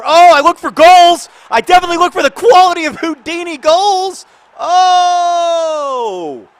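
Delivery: very loud, high-pitched excited shouting; goal celebration. Real, not acted. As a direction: erupt as if your team just scored.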